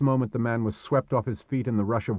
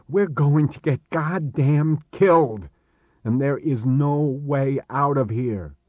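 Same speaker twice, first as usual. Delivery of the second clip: higher, agitated and insistent; panicked outburst; 4 semitones higher, 5 dB louder than usual. Acted. Stranded Chris to Marek with no way home, panicked outburst. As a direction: rant in rising despair, hitting key words hard.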